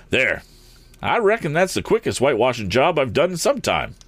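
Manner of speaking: high, gleeful driver; chuckling. A narrator's reading. Eradicate admires his whitewashing job, gleeful. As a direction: crow with amusement, no real laugh. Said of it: "chuckled Eradicate".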